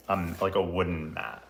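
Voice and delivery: ordinary talk, nothing marked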